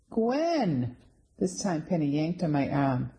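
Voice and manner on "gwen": calling out high and insistent, a mild acted yell. Penny tugging her friend's arm, calls her name insistently. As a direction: snap her name out to finally be heard.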